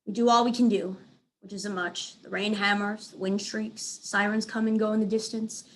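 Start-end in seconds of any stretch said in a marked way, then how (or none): none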